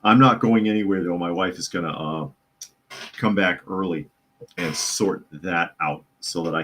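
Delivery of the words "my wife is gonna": ordinary talk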